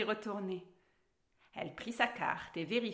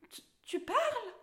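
very high, stammered surprise; astonished cry; 14 semitones higher than usual. A narrator's reading. Alice discovers that the cat talks, astonished. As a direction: stumble on the first word in astonishment. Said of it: "Elle cria".

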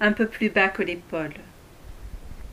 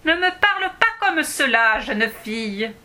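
scolding dwarf; 5 semitones higher, 5 dB louder than usual. Acted.

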